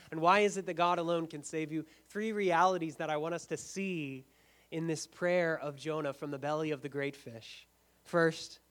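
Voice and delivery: usual speaking voice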